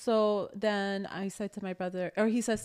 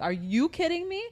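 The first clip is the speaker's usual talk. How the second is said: higher, indignant retort; outraged; 9 semitones higher than usual. Retold or imitated. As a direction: fire it back in disbelief. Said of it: "I'm like".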